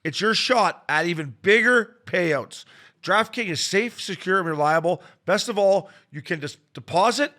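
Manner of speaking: energetic sponsor read. Acted. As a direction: pitch the offer like an announcer. Ad read by host.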